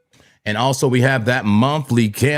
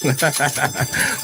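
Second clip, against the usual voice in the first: laughing; 4 dB quieter than usual. Real, not acted. Laughing "five" again.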